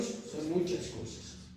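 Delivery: usual speaking voice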